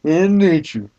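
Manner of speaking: high, airy hippie elf voice; mystical nature voice. Acted. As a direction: intone it like a spiritual elder. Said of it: "why that voice had to be".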